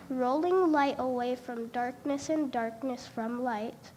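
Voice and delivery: ordinary delivery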